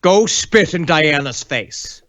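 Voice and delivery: slow, deliberate mock command; a drawn-out order from a cult leader; put-on voice, slowly commanding a henchman; Hubbard giving orders. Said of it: "he added".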